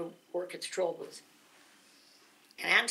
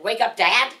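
loud disbelieving question; incredulous; 3 semitones higher, 11 dB louder, slower than usual. Retold or imitated. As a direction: ask it as if told to jump off a cliff. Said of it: "In the sort of voice that you say".

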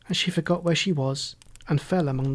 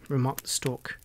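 ordinary delivery

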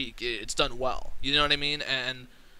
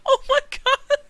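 screaming excitement. Real, not acted. Gamer losing it over the roster, screaming with excitement.

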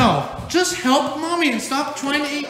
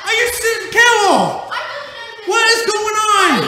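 exasperated disbelief; 6 semitones higher, slower than usual. Acted. Dad finding yet more Happy Meal boxes, exasperated. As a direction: cry out in disbelief.

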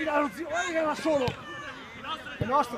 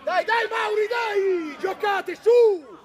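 loud yells, growing louder; exasperated urging shout; 3 semitones higher, 8 dB louder, much slower than usual. Real, not acted. Player urges Mauri and team to play, shouting. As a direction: drive the team on at full voice.

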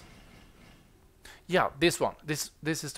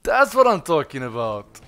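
loud triumphant cheer; excited shout; 3 semitones higher, 13 dB louder than usual. Real, not acted. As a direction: celebrate the win out loud. Celebrating the boss kill.

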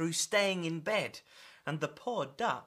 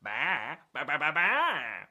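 bleated string of baas; stuttering sheep voice; sheep bleating; 4 semitones lower than usual. Acted. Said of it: "said the sheep".

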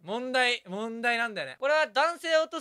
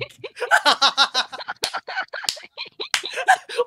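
high, long cackling laugh; long geeky giggling fit, pitched high; nerdy otaku laugh; 13 semitones higher, 5 dB louder than usual. Real, not acted. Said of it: "オタク笑いすぎだ".

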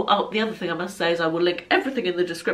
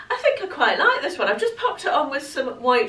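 high, giggly and pleased; laughing; 7 semitones higher than usual. Real, not acted. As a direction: laugh through the words. Pleased trying on dress.

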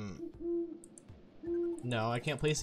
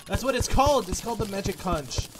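loud, high eureka; excited; 6 semitones higher, 9 dB louder than usual. Real, not acted. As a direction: blurt it out as it comes back. Remembers the name.

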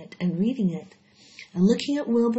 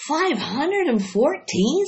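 higher, louder, slow amazed question; astonished; 8 semitones higher, 5 dB louder, slower than usual. Acted. Wilbur hears the number of eggs, amazed. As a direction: gasp out the number in disbelief.

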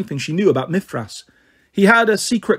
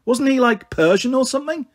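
put-on higher skeptical teen; 8 semitones higher than usual. Annabeth mocks the claim.

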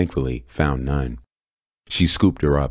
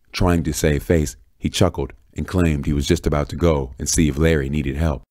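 plain reading voice

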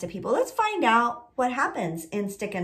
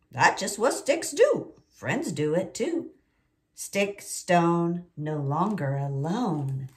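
slow story reading; 4 semitones lower, slower than usual. A narrator's reading. Teacher reading the book.

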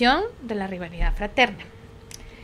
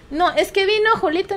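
put-on higher child's excuse; 8 semitones higher, 4 dB louder than usual. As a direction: blame the brother like a kid. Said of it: "otro niño explica". (voz de niño, más aguda)

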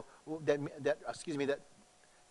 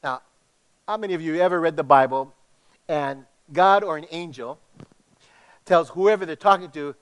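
ordinary talk, nothing marked

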